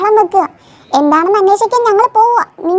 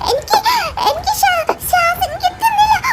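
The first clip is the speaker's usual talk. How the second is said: gasping panic; 10 semitones higher, 4 dB quieter than usual. Acted. Drowning child saying they can't breathe, gasping in panic. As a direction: struggle to get each word out.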